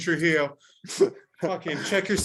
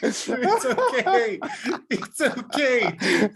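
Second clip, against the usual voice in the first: laughing, drawn out; 9 semitones higher, 4 dB louder, much slower than usual. Real, not acted. Laughing, repeating the slogan.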